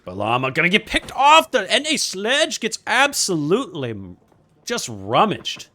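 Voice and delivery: excited mock British play-by-play, swooping at big hits; British accent. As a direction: cast the match like a put-on British commentator. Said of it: "cast in British accent".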